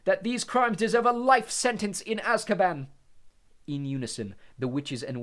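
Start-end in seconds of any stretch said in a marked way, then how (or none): 0.0-2.9 loud, high, slow shout; stern shout, much louder. Acted. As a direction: shout over the room to the jury. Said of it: "shouted Mister Crouch".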